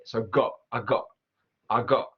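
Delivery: got with a glottal stop, go'. Shown as a demonstration. Teacher demoing the Cockney glottal T in got. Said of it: "the glottal T".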